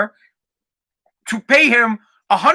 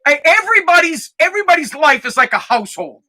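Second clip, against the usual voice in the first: usual speaking voice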